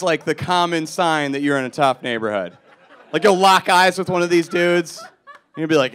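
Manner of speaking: usual speaking voice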